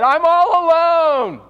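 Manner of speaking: very high, slow self-pitying wail; a hammed-up lament, drawn out; wailing Elijah. Elijah telling God he is all alone, high wailing.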